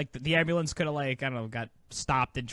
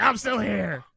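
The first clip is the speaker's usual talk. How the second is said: high mock-menacing call; raised, spooky crook impression; criminal voice; 7 semitones higher, 3 dB louder, much slower than usual. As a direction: imitate a lurking movie blackmailer. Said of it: "he does this criminal voice".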